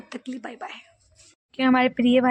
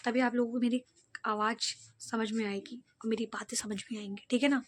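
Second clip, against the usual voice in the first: much softer, gentle talk to viewers; soft loving voice; 11 dB quieter than usual. Shown as a demonstration. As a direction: speak softly and sweetly, like a good girl. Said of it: "अच्छे से आपसे प्यार से बात करनी है". (प्यार से धीमी आवाज़ में बात करना)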